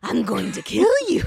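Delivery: fluttery voice turned evil; sweetly evil cartoon villain; Laughin' Sally. Shown as a demonstration. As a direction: drop from falsetto into cute menace. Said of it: "like, fluttery and evil".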